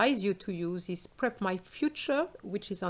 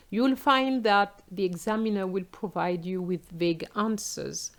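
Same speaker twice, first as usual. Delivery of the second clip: ordinary talk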